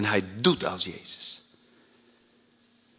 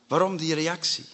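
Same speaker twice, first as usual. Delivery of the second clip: normal talking voice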